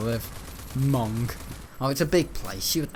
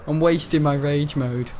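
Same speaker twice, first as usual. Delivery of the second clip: usual speaking voice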